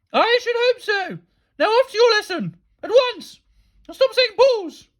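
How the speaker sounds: very high, loud orders; shrieked stern telling-off; headmistress scolding. A narrator's reading. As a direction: dismiss him in imperious shouts.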